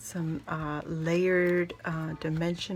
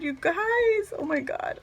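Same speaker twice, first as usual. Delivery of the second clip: ordinary talk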